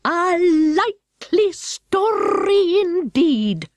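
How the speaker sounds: high, very slow scornful pigeon; contemptuous high pigeon voice; deep contempt. Acted. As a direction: drawl the scorn out slowly. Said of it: "in a tone of the deepest contempt".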